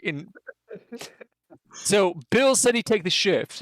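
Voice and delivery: ordinary talk, nothing marked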